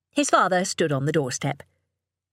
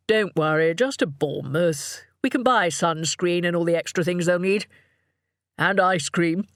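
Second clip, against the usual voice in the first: father's voice. A narrator's reading. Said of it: "added Richard".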